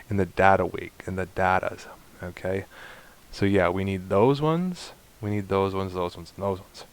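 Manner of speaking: ordinary talk, nothing marked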